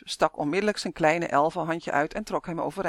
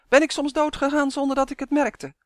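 high, raised-voice indignant question; affronted, pitched-up retort; 8 semitones higher, 4 dB louder than usual. A narrator's reading. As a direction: protest high and forcefully. Elf indignant in quarrel.

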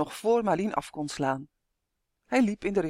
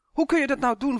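higher and louder, stressing kun; reproachful; 8 semitones higher, 4 dB louder than usual. A narrator's reading. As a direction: scold him for letting Uf go. Tonia reproaches Justin. (verwijtend, hoog en luid)

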